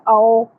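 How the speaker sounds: आओ as a flattened 'ao', ending on o; wrong open diphthong. Shown as a demonstration. Teacher shows what to avoid, open 'ao'. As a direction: end आओ on an unrounded 'o' sound. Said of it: "नहीं पढ़ना".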